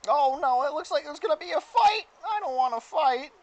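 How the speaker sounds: high, loud squeaky-voiced worrier; Mister Meeseeks. Game master voices Meeseeks refusing a fight, high worried Meeseeks voice. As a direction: push it up high and fret loudly. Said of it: "He summons another Meeseeks".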